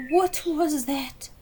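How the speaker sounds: loud, high frightened question; startled cry. Acted. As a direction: gasp it out in alarm. Clementine startled.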